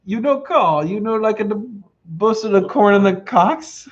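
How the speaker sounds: put-on Italian accent. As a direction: read it like a mock Italian. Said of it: "in Italian accent".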